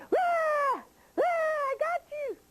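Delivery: very high triumphant shriek; gleeful witch pounce. Acted. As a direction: burst out shrieking like a witch springing a trap. Witch jumps out at the bear.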